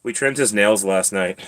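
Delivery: usual speaking voice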